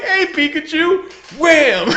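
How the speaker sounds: sweet hello, then hit it; playful high greeting into a bang; gleeful mock greeting. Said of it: "it's like".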